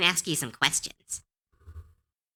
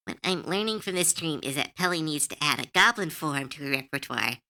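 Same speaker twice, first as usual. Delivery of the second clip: usual speaking voice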